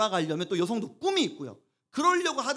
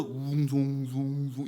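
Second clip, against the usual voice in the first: muffled underwater hum; 6 semitones lower, much slower than usual. Shown as a demonstration. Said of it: "이렇게 들려요".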